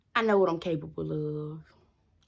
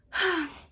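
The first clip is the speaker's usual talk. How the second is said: heavy sigh; 10 semitones higher, 3 dB louder than usual. Real, not acted. Disappointed, swearing-in delayed.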